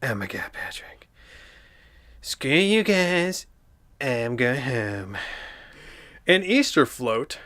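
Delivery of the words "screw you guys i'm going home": slow Cartman quote; Cartman impression. Quoting Cartman leaving the guys, slow. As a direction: storm off like Cartman. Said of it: "it sounded like Cartman".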